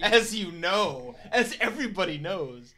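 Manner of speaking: loud, high, drawn-out parody; mocking exposition. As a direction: hammer the obvious, mockingly slow. Mocks the expository line.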